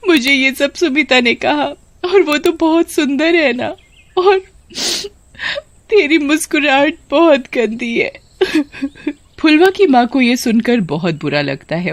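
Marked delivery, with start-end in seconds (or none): 0.1-8.1 high-pitched sobbing complaint; acted tearful wail; crying. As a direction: tell mother through tears who hurt you. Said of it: "ऐसे क्यों रो रही हो".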